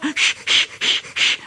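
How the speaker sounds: whispered shh puffing rhythm; soft unvoiced chuffing; engine puffs. A narrator's reading. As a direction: puff like a steam engine.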